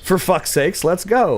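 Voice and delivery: excited cheer. Real, not acted. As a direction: fire up your friend. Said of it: "I said".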